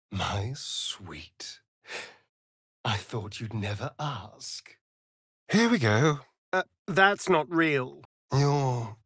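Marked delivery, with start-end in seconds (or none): 0.1-4.8 breathy low whisper of invitation; seductive whisper. Acted. Vampire whispering to his lover, seductive. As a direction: purr it close to her ear.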